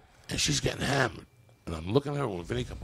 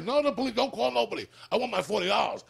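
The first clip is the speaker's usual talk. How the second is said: high mock husband; 12 semitones higher than usual. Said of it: "the husband's like".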